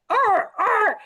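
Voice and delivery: high barking yelp; dog bark. Retold or imitated. S1 imitates her short hair barking from the truck. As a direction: bark like the left-behind dog.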